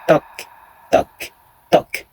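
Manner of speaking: eerie whispered knocking; whispered knocks. A narrator's reading. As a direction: breathe the knocks like a distant echo. Ghostly knocking at night.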